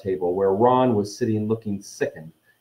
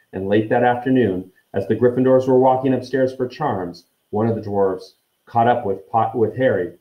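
ordinary delivery

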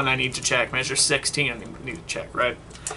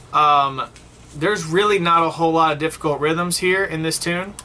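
normal talking voice

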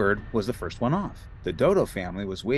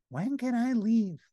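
high, slow eager Big Bird; high childlike bird, keen to go; 11 semitones higher than usual. A narrator's reading. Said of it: "Big Bird looked at Miss Finch and said".

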